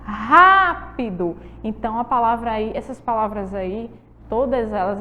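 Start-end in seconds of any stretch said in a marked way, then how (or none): 0.0-1.4 Rápido very slow, "Rá" drawn out; stressed first syllable. Shown as a demonstration. Teacher repeats to show the tonic syllable, drawn-out "Rá" in Rápido. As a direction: lean long on the "Rá" of Rápido. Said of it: "Olha a sílaba tônica".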